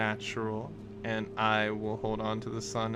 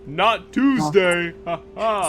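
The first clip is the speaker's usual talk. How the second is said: slow, loud teasing with a laugh; mocking retort; 9 semitones higher, 11 dB louder, slower than usual. GM teasing the player, slow and laughing. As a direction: drag it out and laugh at him.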